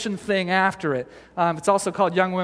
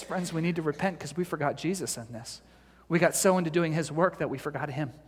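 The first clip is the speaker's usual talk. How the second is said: quiet confession; 3 semitones lower, 7 dB quieter than usual. Retold or imitated. Humbled leader calls to repent.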